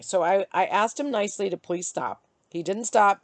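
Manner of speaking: ordinary talk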